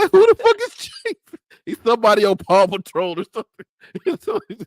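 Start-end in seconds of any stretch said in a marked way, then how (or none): 0.1-1.1 much higher, louder laughing outburst; laughing disbelief. Retold or imitated. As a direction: blurt it mid-laugh. Father retelling reaction, laughing.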